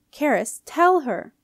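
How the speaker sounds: exasperated command, rising high and loud; an old queen losing patience, snapping an order; loud exasperated order to her daughter. A narrator's reading. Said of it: "Queen Matilda was exasperated".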